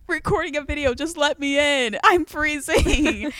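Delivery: loud, high, drawn-out mock wail; exasperated begging, stretched at the end; retold desperate plea.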